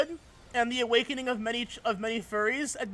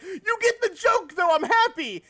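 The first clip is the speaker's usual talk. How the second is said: high, delighted exclamation; excited quote; 9 semitones higher, 6 dB louder than usual. Retold or imitated. Streamer telling a fellow player they got the joke, delighted. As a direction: gush with happy surprise. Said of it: "I'm like".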